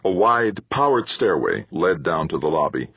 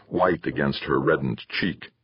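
ordinary delivery, nothing marked

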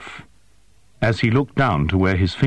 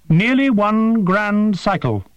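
high, precise voice of a small winged creature; formal, formal alien creature, pitched high; 12 semitones higher, slower than usual. A narrator's reading. Creature Prath speaks.

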